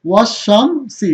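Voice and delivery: "ওয়াশ শামসি" at half speed, sh held long; model pronunciation. Shown as a demonstration. Teacher shows students the joined reading, slow drawn-out "ওয়াশ শামসি". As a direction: stretch "ওয়াশ শামসি" slowly, doubling the sh. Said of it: "কি হবে?".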